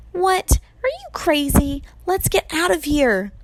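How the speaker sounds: high-pitched, panicky objection; alarmed disbelief. A narrator's reading. Percy balks at attacking Medusa, alarmed and high.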